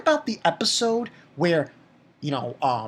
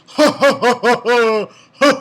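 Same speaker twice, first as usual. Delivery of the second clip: loud, high "ha ha ha"; hammed-up evil laughter; mocking villain laugh; 6 semitones higher, 10 dB louder than usual. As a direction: imitate a villain's big gloating laugh. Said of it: "he's then laughing in her face".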